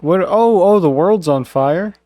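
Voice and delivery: ordinary talk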